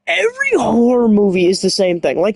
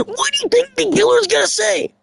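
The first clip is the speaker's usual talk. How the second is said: much higher, squeaky question; high incredulous question; 13 semitones higher than usual. Real, not acted. Streamer asking chat about the killer, squeaky disbelief.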